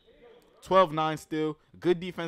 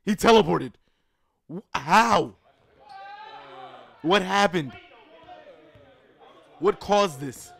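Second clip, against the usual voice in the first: amazed disbelief; 5 semitones higher, 7 dB louder, slower than usual. Real, not acted. Disbelief at play.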